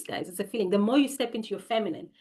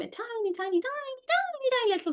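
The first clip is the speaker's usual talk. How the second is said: tiny squeaky voice; faster than usual. Acted. Describing a tiny sperm in a high squeak. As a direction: shrink the voice down to tiny. Stressing how small sperm is.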